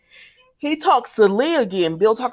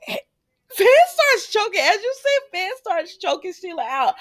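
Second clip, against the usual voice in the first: high squealing amazement, stretched out at first; high-pitched, tickled recap; incredulous amusement; 11 semitones higher than usual. Real, not acted. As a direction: squeal it in disbelieving delight. Amused shock at the plot twist.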